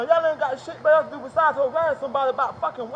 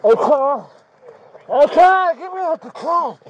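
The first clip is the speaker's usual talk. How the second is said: distressed pleading; 3 semitones higher, 8 dB louder, slower than usual. Real, not acted. Suspect distressed in police car.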